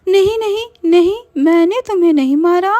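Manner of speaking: very high, pleading voice; frightened denial. Acted. Meenal denying the murder to the witch, high pleading. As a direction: beg her to believe you. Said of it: "Meenal कहती है".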